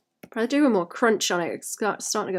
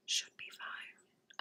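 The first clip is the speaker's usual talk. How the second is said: whisper; 19 dB quieter, much slower than usual. Real, not acted. Muttering to herself over tech.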